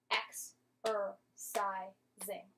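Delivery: Ex-er-cising said slowly, each syllable split apart; slow teaching breakdown of Ex-er-cising. Shown as a demonstration. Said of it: "Let's do it one more time".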